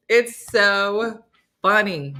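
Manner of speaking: usual speaking voice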